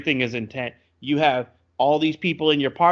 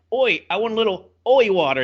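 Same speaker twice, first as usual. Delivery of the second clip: put-on higher, louder mangled Welsh-country accent; 8 semitones higher, 5 dB louder than usual. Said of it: "took a Welsh accent and smeared it".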